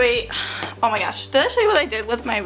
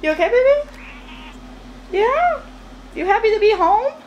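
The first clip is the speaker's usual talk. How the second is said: pet talk; 10 semitones higher than usual. Real, not acted. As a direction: coo at the cat in a high voice. Talking to her cat Cheetah.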